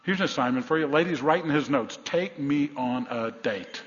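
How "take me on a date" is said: drawn-out, deliberate dictation; measured, as if for someone writing it down; slow dictation. Acted. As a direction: spell it out slowly, word by word. Dictating a note to wives.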